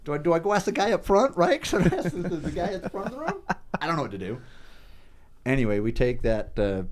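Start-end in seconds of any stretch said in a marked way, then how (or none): 0.0-4.4 high, exasperated pleading; much higher, at a loss. Retold or imitated. Retelling pleading bewilderment.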